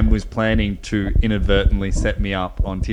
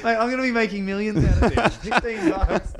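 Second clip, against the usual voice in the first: high, giddy, laughing delivery; tickled and incredulous, voice riding high on laughter; laughing with glee; 10 semitones higher, slower than usual. Real, not acted. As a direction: crack up as you talk, pitch shooting up. Giddy over a cheap domain price.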